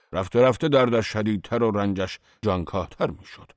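usual speaking voice

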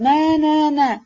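high, urgent protest; alarmed cry. A narrator's reading. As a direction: cry out hurriedly to stop someone. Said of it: "شتابان پیش آمد و فریاد زد".

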